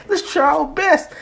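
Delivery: high, eager rallying voice; acted high-pitched enthusiasm; pumped-up student. Pumped student rallies others.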